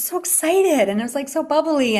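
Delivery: a high, bubbly retelling of her energy. Retold or imitated. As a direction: mimic her bubbly excitement. Describing bubbly woman's energy.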